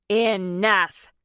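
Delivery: loud booming command; booming shout. A narrator's reading. Nana Ruby stopping the whole brawl with a booming shout. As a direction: boom it to stop everyone. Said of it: "Nana Ruby shouted in a loud boom".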